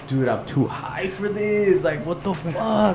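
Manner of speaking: slow, high-pitched stoned disbelief; retold too-high-to-cope whine; overwhelmed stoner. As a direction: drag it out, high and helpless. Retelling being too high for police.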